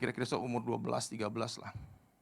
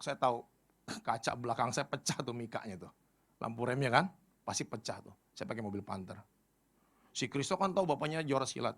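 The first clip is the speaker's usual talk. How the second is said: ordinary talk, nothing marked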